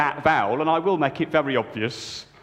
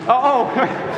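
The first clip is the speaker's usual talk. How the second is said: mock dismay; 8 semitones higher, 5 dB louder than usual. Mock gasp at getting old.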